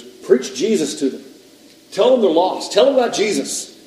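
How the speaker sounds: loud, rising exhortation; urgent command. Acted. Guide telling a visitor to preach to monks, urgent. Indian man urging him to preach.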